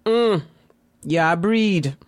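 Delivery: imitating mother's West Indian accent; lightly voiced West Indian mother, scolding; a hint of a West Indian mother admonishing. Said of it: "with her thick West Indian accent".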